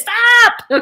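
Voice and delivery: loud, high real yell; sudden real yell at the dogs; sharp shout. Real, not acted. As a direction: bark the word stop at the dogs. Dogs fighting.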